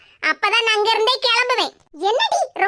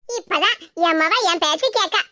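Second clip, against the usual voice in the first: ordinary talk, nothing marked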